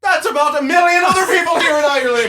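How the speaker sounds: very high, loud mock scorn; Irish bartender. Bartender dismissing tourist's surname, mock Irish shouting. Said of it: "he's the bartender".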